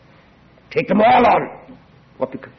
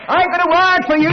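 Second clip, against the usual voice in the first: high, loud proclamation of the giant preacher; mock booming announcement; booming evangelist; 11 semitones higher, 4 dB louder than usual. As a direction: announce it like a big revivalist. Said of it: "in comes Herbert".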